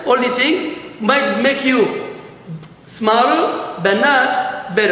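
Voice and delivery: slow, pressed science doesn't make you; emphatic moral. Real, not acted. Teacher stressing the lesson to the class, slow. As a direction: spell out the lesson slowly. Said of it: "moral repeated".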